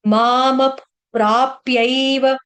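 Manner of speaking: माम प्राप्यैव with a beat on the half प; maamap-praapyaiva, p held at the join; stressed half consonant. Shown as a demonstration. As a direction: strike the joined p in माम प्राप्यैव. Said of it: "आघात आधे प का".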